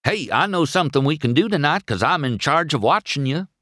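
fast, high, eager figure voice; lively, quick yokel chatter; chatty country buddy. A narrator's reading. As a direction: pitch an idea excitedly to a pal. Voicing Mater to McQueen.

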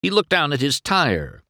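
ordinary delivery, nothing marked

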